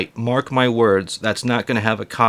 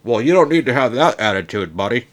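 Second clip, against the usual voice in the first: offended parent voice. Retold or imitated.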